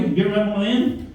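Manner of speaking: ordinary talk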